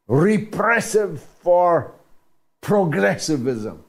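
Repressive drawn out slow and high, leaning hard on it; sarcastic disbelief, hammering the word Repressive; indignant emphasis. Real, not acted. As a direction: stretch out Repressive, outraged and slow. Host's indignant rhetorical emphasis.

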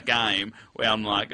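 ordinary delivery

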